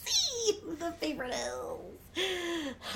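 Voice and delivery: teasing daughter about favorites, laughing; laughing mock jealousy. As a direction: laugh then slow teasing complaint. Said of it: "we've known ... loves Bart more".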